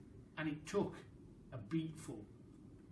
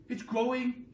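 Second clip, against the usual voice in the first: put-on higher, louder boy's shout; excited yell; 7 semitones higher, 6 dB louder than usual. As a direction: yell out the wonder. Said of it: "he yelled".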